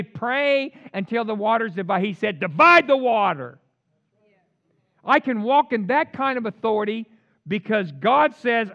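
emphatic, driving sermon delivery; full-force preaching. Real, not acted. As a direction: exhort the congregation with force.

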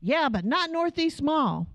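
high, slow mock-indignant retort; imitating fuming daughter. Daughter argues with studio lady about the mall, mock indignant. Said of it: "fuming mad".

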